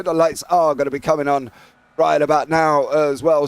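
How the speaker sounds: ordinary talk, nothing marked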